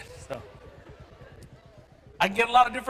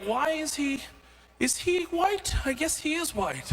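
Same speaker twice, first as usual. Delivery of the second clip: puzzled onlooker; 7 semitones higher than usual. Acted. Puzzled onlookers guess his race.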